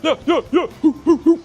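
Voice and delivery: high, loud celebratory hoots; excited whooping. Real, not acted. Celebrating as the flight gets booked, giddy whooping. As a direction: chant it in short, high, giddy bursts. Celebrating while booking with United.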